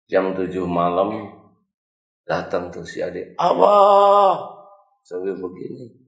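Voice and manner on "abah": high, loud call for dad; boy bursting home calling his father; joyful shout. Acted. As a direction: yell for father from the door.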